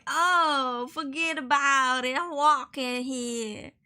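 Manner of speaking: hammed-up New York mafia accent; mock New York mobster. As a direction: drag out the words like a movie New York gangster. Love The Godfather.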